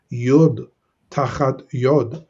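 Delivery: "Yod tachat yod" read slowly with a pause after "Yod"; slow Hebrew reading. Shown as a demonstration. Teacher reads the verse to students, slow. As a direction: sound out "Yod tachat yod" carefully. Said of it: "notice the verse here".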